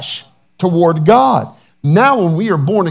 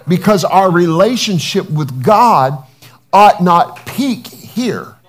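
loud, slow preaching climax; 3 semitones higher, 5 dB louder, slower than usual. Acted. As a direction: build the sermon to full force. Preaching at full force.

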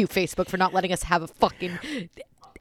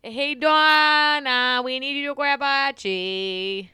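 very slow, sustained holler; raised, drawn-out singsong call; 3 semitones higher, 4 dB louder, much slower than usual. Real, not acted. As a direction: stretch every word to carry far. Calling out to Donna for help.

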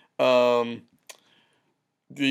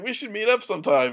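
higher-pitched hopeful suggestion; light, pitched-up casual invite; retold eager invitation; 7 semitones higher than usual. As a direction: imitate your younger self proposing. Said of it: "I was like".

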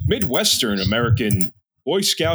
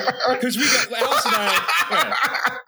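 laughing through the words; 8 semitones higher, much slower than usual. Real, not acted. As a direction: giggle while you talk. Laughing while telling a story.

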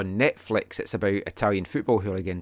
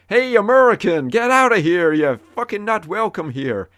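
loud, high mock American accent; 8 semitones higher, 5 dB louder than usual. Imitating a badly dubbed film, hammed-up American accent. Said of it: "it's a guy in an American accent".

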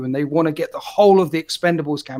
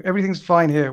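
normal talking voice

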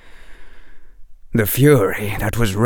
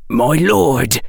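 disguised whisper; 4 semitones higher than usual. A narrator's reading. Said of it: "whispered the disguised voice".

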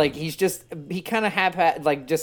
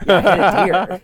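louder, deadpan prank caller voice; mock caller; 4 semitones higher, 10 dB louder than usual. Jim Florentine's caller to the business, loud offhand mock voice.

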